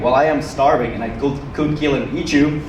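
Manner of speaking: normal talking voice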